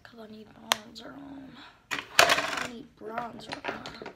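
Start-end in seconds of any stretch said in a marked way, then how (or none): 2.2-4.1 slow, loud, stretched line; louder and slow; drawn-out and louder. Acted. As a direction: drag it out, playfully insistent. Repeats the line in a new way.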